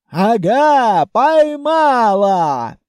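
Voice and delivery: loud, drawn-out gotcha; triumphant shout. Acted. Grandmother bursts into the tower, triumphant shout. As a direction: crow loudly over the catch.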